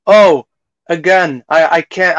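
ordinary delivery

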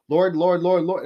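nagging God over and over; impatient pleading. Retold or imitated. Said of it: "we're just going".

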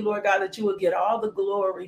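normal talking voice

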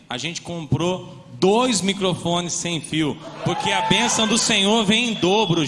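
loud, high excited shout. Real, not acted. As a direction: shout the blessing out with joy. Excited preaching announcement.

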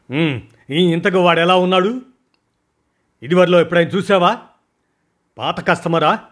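high, loud, fast questioning; shower him with questions; rapid-fire questions. Acted. Boss fires questions at his man, high, loud and fast. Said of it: "ప్రశ్నలు కురిపించాను".

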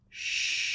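a soft, airy hush; whispered shh. Acted. Sloth hushes Sasha so she can listen, whispered. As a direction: hush her gently in a whisper.

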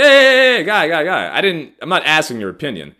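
loud, high hey hey hey cutting him off; loud insistent interruption; retold firm cutoff. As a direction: shut the friend down loudly. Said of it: "And I was like".

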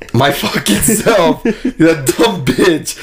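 slow, laughing rant. Real, not acted. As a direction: drag it out through laughter. Venting about umpire, laughing.

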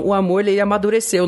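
ordinary talk, nothing marked

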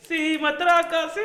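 high, slow, loud yell; loud shout. Retold or imitated. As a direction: shout it like the app. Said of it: "Y yo empecé".

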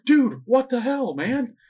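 high-pitched incredulous dude; squeaky "what the hell" outburst; exasperated mock protest. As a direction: act out a baffled, high-voiced complaint. Acted movie argument in a retelling.

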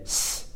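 s as a hiss between sh and s; sit the s between sh and plain s; hiss demo. Shown as a demonstration. Teacher showing pinyin X, demonstrating the hiss. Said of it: "kind of like a hiss".

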